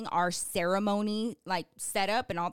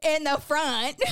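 mocking husband; 6 semitones higher, 6 dB louder, slower than usual. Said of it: "I was like".

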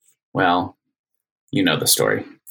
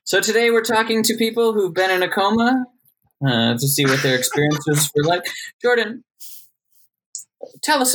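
talk show host; 9 semitones higher than usual. Acted.

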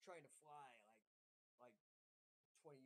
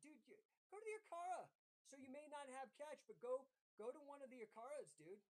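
excited urging; 8 semitones higher, 5 dB louder than usual. Real, not acted. Excitedly urges the Indian viewer.

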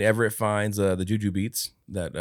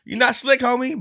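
loud, high, sassy telling-off; mock calling-out; 8 dB louder than usual. Ex-wife catching Everett's lie, mock scolding. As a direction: call him out, high and loud.

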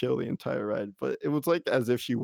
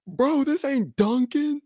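slow, much higher bro voice; high mock bro; 5 dB louder, slower than usual. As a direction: ham up the friend's bro joke. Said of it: "He was like".

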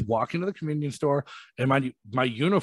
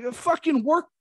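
exasperated retort; 6 semitones higher than usual. Retold or imitated. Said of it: "I'm like".

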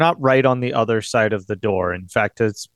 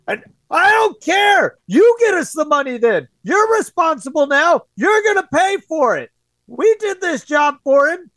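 much higher, louder thug yelling through a door; angry men screaming; 5 dB louder than usual. Acted. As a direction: bellow like a furious debt collector. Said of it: "you hear the men screaming".

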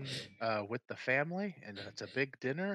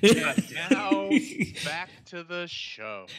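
announcer voice; 4 semitones higher, 9 dB louder than usual. Pretend. Parody announcer sign-off.

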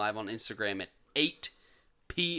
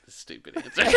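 laughing; 7 dB louder than usual. Real, not acted. Laughing at answer.

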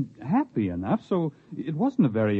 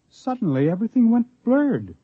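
sudden high, louder burst on the last words; dramatic reveal; 10 semitones higher, 4 dB louder than usual. Acted. Castaway telling visitors of the strange blur, rising sharply. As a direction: let it leap up in alarm. Dramatic climax of retold story.

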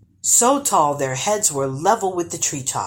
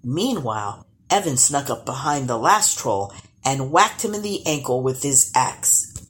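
plain reading voice

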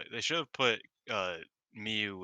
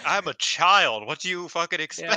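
bratty retort; 7 semitones higher, 10 dB louder than usual. Retold or imitated. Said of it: "Rina's like".

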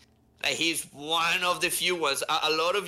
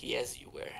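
deadpan quiet sarcasm; 3 semitones lower, 10 dB quieter than usual. Retold or imitated. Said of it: "I was like".

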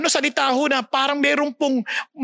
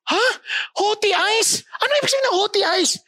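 mock puzzled; 7 semitones higher than usual. Rhetorical question to congregation.

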